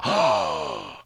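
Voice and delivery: sharp scared intake of breath; mock gasp of fear. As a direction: gasp like a caught kid. Mock fear at mom's threat.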